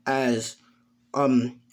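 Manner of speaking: usual speaking voice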